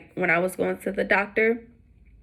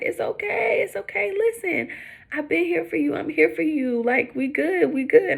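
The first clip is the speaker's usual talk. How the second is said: much higher mock husband voice, soothing; husband consoling; 9 semitones higher than usual. Husband reassuring his crying wife, high mock voice. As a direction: play the spouse calming her down.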